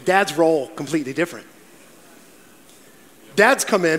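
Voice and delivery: ordinary talk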